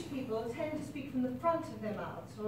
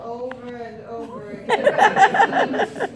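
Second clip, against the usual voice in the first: laughing; 13 dB louder, much slower than usual. Real, not acted. Laughing while recounting notes.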